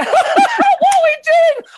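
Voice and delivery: high, excitable English comic with a cackle; Ricky Gervais. Shown as a demonstration. Showing English made bigger, high laughing Ricky Gervais voice. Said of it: "make it like Ricky Gervais English".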